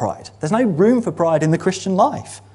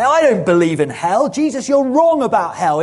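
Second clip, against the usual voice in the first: higher, louder mock protest to Jesus; a hammed-up indignant believer; defiant objector; 6 semitones higher, 5 dB louder than usual. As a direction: play the stubborn churchgoer arguing back. Preacher voicing a defiant objector.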